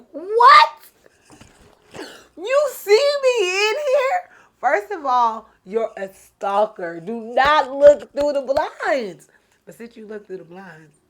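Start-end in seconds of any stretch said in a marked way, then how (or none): 0.1-4.3 loud, very high, drawn-out mock outrage; shrieked disbelief. Woman hears he can see her inside, shrieking disbelief. Acted shock at being seen. 4.6-9.3 loud, slow, emphatic telling-off; mock scolding. Woman tells off the man at her blinds, loud mock scolding.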